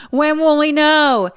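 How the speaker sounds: high, loud, drawn-out demand; retold urgent question. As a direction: call out urgently, stretching the words. Retelling Dad calling out in panic.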